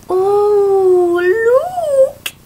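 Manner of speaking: high squeal at a new toy; excited squeal. Real, not acted. Thrilled squeal to her viewers over a cute toy. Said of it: "Sorry, I get so excited".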